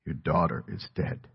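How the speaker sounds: low, soft, weighty delivery; grave. Retold or imitated. Messenger telling a father of his child's death, grave. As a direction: let the terrible news fall quietly. Dwells on the crushing words.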